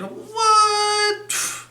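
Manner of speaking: high squeaky what; mock shock, way up high; surprised. Surprised reaction in song.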